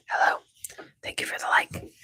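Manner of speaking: softly whispered "Thank you for the like.". Pretend. Streamer thanking a viewer for the like in a whisper. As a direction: breathe it out as a hushed whisper. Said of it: "I'm not whispering".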